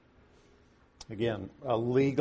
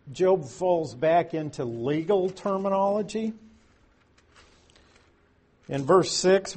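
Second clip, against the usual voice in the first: ordinary delivery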